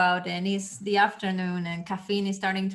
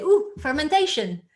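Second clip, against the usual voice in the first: high, loud eager outburst; excited exclamation; 7 semitones higher, 6 dB louder than usual. Retold or imitated. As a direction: blurt it out like a bright idea. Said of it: "someone very excitedly jumped and said".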